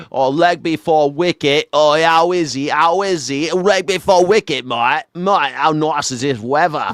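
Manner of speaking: loud, hammed-up Australian accent; mock Australian accent. Host parodying Australian guest's cricket talk, loud mock Australian accent. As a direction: call out like an exaggerated Aussie cricket fan. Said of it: "it sounds like it's Australian".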